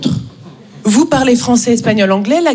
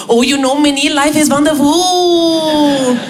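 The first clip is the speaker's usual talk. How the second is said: blissful Indian colleague; slower than usual. Retold or imitated. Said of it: "il allait trop bien".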